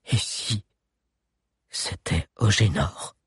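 hollow, hushed, like a ghost; sepulchral voice. Acted. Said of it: "d'une voix d'outre-tombe".

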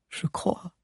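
unvoiced murmur. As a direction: murmur it under your breath. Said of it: "murmura Agnès".

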